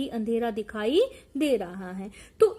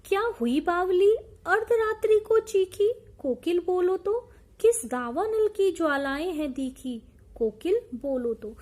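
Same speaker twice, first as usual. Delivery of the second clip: raised, emphatic reciting of verse; poem recitation; 4 semitones higher, 3 dB louder than usual. Acted. Teacher reciting the poem to the class, in recitation voice. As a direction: declaim the lines with rising force.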